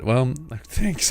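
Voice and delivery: usual speaking voice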